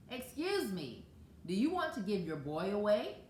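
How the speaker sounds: ordinary delivery, nothing marked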